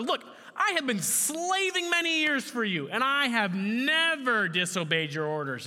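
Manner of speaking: high, heated self-justification; angry older son. Acted. Older son answering his pleading father, angry. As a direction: protest hard, starting very high. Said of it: "Then he became angry".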